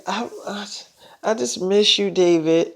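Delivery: breathy hushed whisper, voice almost gone. Retold or imitated. Joey telling David she misses him, in a whisper.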